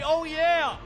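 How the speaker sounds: high, loud crowd-pumping shout; hype shout. Shown as a demonstration. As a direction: belt it out to lead the crowd. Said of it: "If you're ready, say".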